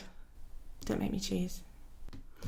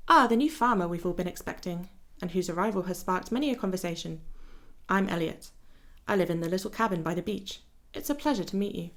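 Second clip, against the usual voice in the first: ordinary delivery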